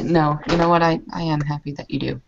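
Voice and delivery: ordinary talk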